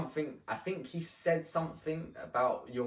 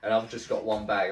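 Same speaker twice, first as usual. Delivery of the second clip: ordinary delivery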